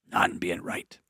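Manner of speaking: breathy whisper on the last words. Real, not acted. Host to caller, closing his advice in a whisper. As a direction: let "not in being right" fall to a hush.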